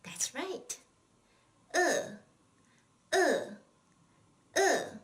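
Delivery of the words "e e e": 饿 said è, sharply falling, higher and louder; fourth tone drill. Shown as a demonstration. Teacher drilling the word for hungry: falling è. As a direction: drill è three times, each a hard fall. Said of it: "what is the word for hungry in Chinese".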